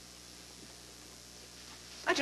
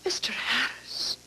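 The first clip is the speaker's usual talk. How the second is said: whisper. Acted. Shocked at being accused.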